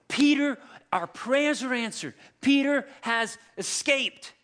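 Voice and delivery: high, loud excited cry of good news; excited announcement. Retold or imitated. Disciples bursting in, excitedly announcing Peter's escape.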